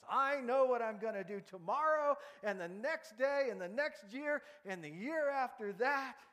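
boasting big plans to the future; confident George. Acted. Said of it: "he said to Mary".

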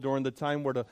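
ordinary delivery

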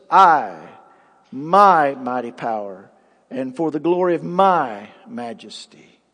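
"I" punched loud, "my mighty power" leaned on; highlighting the king's boast, stressing "I" and "my"; emphatic I and my. Shown as a demonstration. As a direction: hit "I" and every "my".

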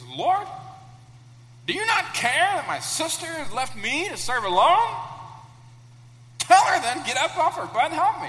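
high, loud, complaining plea; indignant Martha. Acted. Preacher voicing Martha demanding Jesus make her sister help. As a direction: whine at Jesus like a fed-up sister. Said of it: "I didn't say that. That was my translation".

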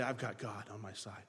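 low, soft, steady confidence; a hint of calm defiance; quiet resolve. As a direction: say it quietly, sure of God. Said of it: "you have to say".

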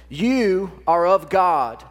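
lightly voiced God, slow and weighty; a hint of a fatherly God, drawn out; slow, solemn voice of God. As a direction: speak as God to his child, slow and grand. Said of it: "This is God speaking to you this morning".

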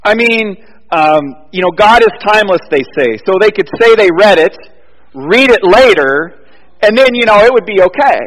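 loud, high preacher's build; preaching at full force. Acted. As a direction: drive the point home from the pulpit. Sermon rising to full force.

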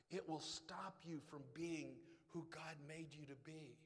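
normal talking voice

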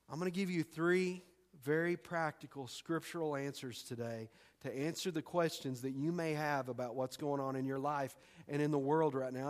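ordinary talk, nothing marked

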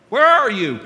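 loud, high confused call; loud phone shout. Retold or imitated. Father shouting back at his son on the phone. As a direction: yell it down a bad line. Dad on bad phone line.

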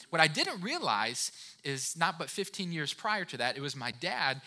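usual speaking voice

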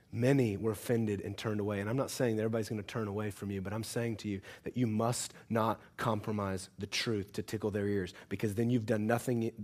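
soft, low, grave sermon voice; quiet earnest preaching. Acted. Preacher warning his church, soft and grave. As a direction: pull them in softly with weight.

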